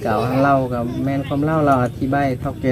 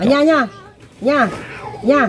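raised voice hailing grandma; calling out to grandma; 10 semitones higher than usual. Real, not acted. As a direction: call grandma so she hears you.

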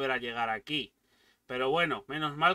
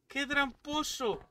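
high, slow, drawn-out exclamation; amused complaint; 10 semitones higher, slower than usual. Real, not acted. Player calling the game a cheat, amused high complaint. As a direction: complain through amusement, pitched up. Laughing at the game's trick.

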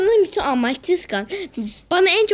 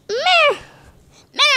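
mocking bleat; 12 semitones higher, 3 dB louder than usual. Real, not acted. Mocking friend after bad play.

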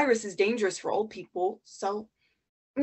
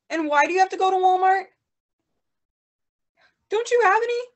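exasperated disbelief; 10 semitones higher, 7 dB louder than usual. Acted. Exasperated with grandma on phone.

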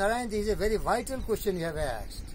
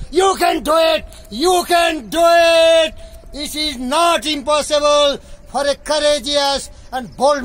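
high, very loud, drawn-out proclamation; rousing shout; 6 semitones higher, 10 dB louder, slower than usual. Acted. Reciting the poem's climax to his guest, rousing triumphant shouting. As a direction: shout it out slowly like a rallying cry.

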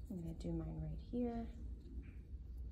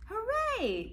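mock cheer; 7 dB louder than usual. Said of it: "yelled Molly's parents".

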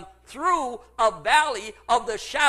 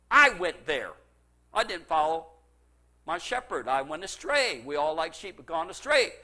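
normal talking voice

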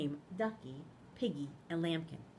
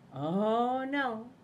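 loud dismayed Lambkin; 3 semitones higher, 8 dB louder than usual. Acted. Lambkin refuses.

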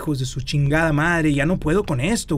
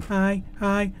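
ordinary talk, nothing marked

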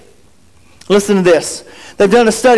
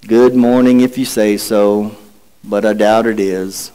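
gloomy Eeyore; 12 semitones lower than usual. Acted. Preacher reading Eeyore to the congregation, mopey low drone. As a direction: sigh it out like a glum old donkey. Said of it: "To which Eeyore replies".

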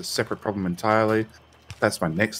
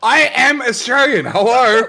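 loud, high-pitched mock outrage; slow, incredulous exclamation, much higher and louder; indignant protest; 13 semitones higher, 7 dB louder, slower than usual. Real, not acted. Australian protesting friend's boast, loudly indignant.

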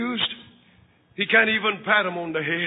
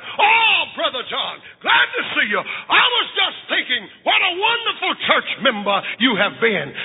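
loud, high, gushing flattery; fawning priest; 7 semitones higher, 3 dB louder than usual. Acted. Priest greets John with loud gushing flattery. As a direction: priest laying it on thick.

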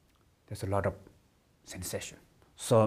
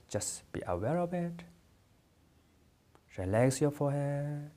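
guided meditation. Acted. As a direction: lead them unhurriedly through the body, drawing out phrase endings.

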